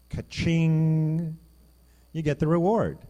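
loud, high jackpot cry; excited payoff. Real, not acted. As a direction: ring it out like a cash register. Preacher's jackpot payoff line.

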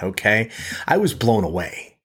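usual speaking voice

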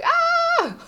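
put-on very high childlike squeal of overwhelm; high inner-child squeal. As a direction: let out a tiny high squeal. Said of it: "was a bit like".

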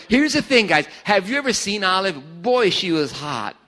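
ordinary talk, nothing marked

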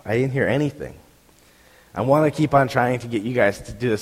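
ordinary talk

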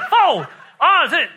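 high, loud and frantic; comic panicked exchange; urgent mock shout. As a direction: call it out as if falling. Acts out urgent exchange in free fall.

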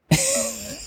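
high squealing laugh; real helpless laughter. Real, not acted. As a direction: crack up in a high wheeze. Cracks up at mom's slang.

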